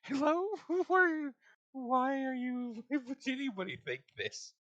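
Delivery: high, loud disbelieving hello; incredulous. Retold or imitated. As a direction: squeak out who are you in disbelief. Mock addressing the censors.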